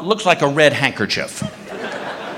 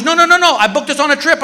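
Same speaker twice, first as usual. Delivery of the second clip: protesting wife; 8 semitones higher than usual. Retold or imitated. Wife pleading with annoyed husband.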